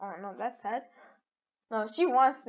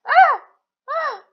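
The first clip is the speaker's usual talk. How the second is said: two short high yelps, the first louder; startled yelps; 13 semitones higher, 9 dB louder than usual. Real, not acted. Player startled in a game, yelping. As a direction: yelp sharply in alarm, twice.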